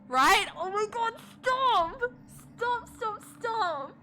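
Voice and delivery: high, loud, tearful shrieks; rising panic, high-pitched and near crying; panicked pleading. Real, not acted. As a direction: beg the game to stop, squealing. Panicked reaction to creepy game.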